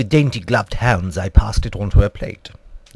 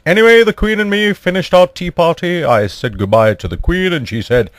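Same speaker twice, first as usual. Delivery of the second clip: ordinary delivery, nothing marked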